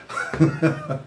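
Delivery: a breathy, voiceless chuckle; soft laugh. Real, not acted. Preacher laughing at his own acting, breathy chuckle. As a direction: laugh quietly at yourself.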